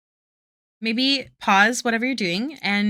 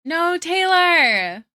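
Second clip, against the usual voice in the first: drawn-out, higher, louder "No, Taylor!"; loud slow call-out; 8 semitones higher, 6 dB louder, much slower than usual. Real, not acted. Artist urging a viewer to stop self-criticism, loud drawn-out call. Streamer calls out to chatter Taylor.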